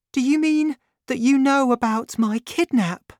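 put-on high, light grateful young woman. A narrator's reading. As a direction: lift the voice for the young heroine.

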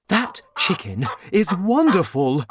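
high, thrilled father's voice; excited cry. A narrator's reading. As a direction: cry out in delighted wonder. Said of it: "he cried".

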